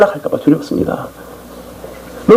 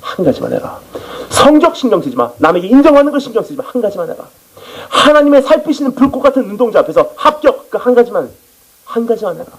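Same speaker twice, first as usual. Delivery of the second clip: voice of the Lord; 6 semitones higher than usual. Acted. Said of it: "주님께서 말씀하십니다".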